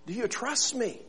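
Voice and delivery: soft, gentle asking; calm question. Acted. God gently asking the man about trust. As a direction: ask quietly and kindly. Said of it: "returned with a question".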